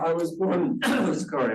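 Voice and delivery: usual speaking voice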